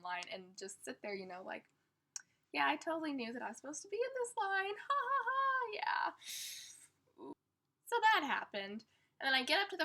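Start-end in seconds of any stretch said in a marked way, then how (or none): 2.5-7.3 fake casual. Fake-casual cover while embarrassed.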